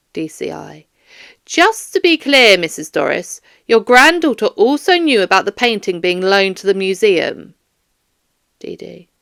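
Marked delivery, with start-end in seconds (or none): 1.4-7.5 louder, pointed police questioning; firm detective. Acted. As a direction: pin the witness down. Detective presses Doris.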